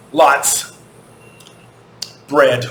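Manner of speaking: normal talking voice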